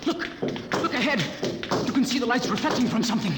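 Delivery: breathless discovery, voice lifted; excited urging. Acted. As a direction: spur her on as hope appears. Urging her toward the lights.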